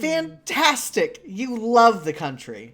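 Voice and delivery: loud, slow, high mock delight; drawn-out ironic enthusiasm; sarcastic cheer. Sarcastic GM narration.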